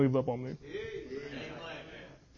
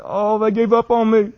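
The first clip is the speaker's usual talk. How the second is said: higher, louder, slow wail; anguished crying quote; 7 semitones higher, 8 dB louder than usual. Retold or imitated. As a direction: cry it out like a broken man. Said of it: "He said".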